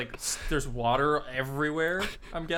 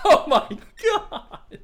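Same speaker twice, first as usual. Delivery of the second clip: high, loud, slow laughing cry; reacting to the cabbie's scream; 14 semitones higher, 11 dB louder, much slower than usual. Real, not acted. Laughing at the scene.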